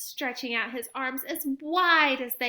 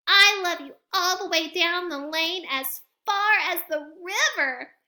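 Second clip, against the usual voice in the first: high, loud little hare calling out; little hare crying out across the river; cried out; 7 semitones higher, 7 dB louder than usual. Acted. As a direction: call it out far down the lane. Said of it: "cried Little Nut Brown Hare".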